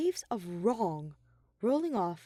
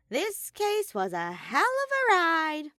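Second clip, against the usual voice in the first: slow, high, louder bear voice; reminiscing Monokuma; 10 semitones higher, 5 dB louder, slower than usual. A narrator's reading. Monokuma savouring the case with Shuichi.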